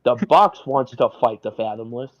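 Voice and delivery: loud, triumphant in-character declaration; loud announcement. Acted. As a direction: speak up loudly, claiming proof. Speaks aloud after secret whisper.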